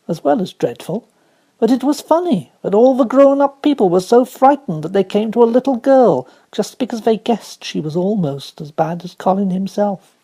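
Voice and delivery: ordinary delivery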